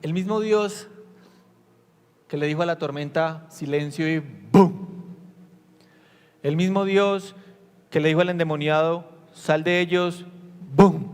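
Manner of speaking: más alto y más fuerte, cada vez con más fuerza; predicación intensa. Acted. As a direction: proclama el poder de Dios a la congregación. (predicación apasionada y fuerte)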